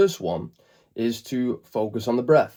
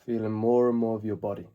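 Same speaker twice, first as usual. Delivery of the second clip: calm meditation guide, long pauses between phrases; spacious, settling voice, growing softer toward the end; guided meditation; 4 dB quieter than usual. Acted. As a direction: ease them into the present moment, unhurried.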